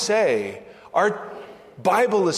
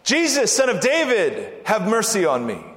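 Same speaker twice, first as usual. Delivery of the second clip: raised, pleading call; crying out; 3 semitones higher than usual. Acted. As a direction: call out for mercy, pitch falling away as it ends. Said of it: "the man cried out".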